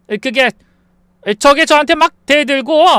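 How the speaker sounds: halting excuse, high and defensive; stall, then blurt a feeble complaint; flustered hesitation. Acted. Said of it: "우물쭈물하는 시어머니".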